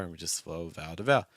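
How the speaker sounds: ordinary talk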